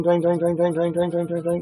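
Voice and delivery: repeated twang noise, fading away; playful twanging sound effect; imitated twang. Imitating tweezers vibrating in knee.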